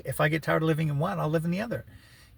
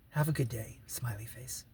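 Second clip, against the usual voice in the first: breathy unvoiced sign-off; whispered sign-off; 4 semitones lower, 8 dB quieter than usual. Quoting his text to the woman, whispered. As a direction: whisper the goodbye sweetly.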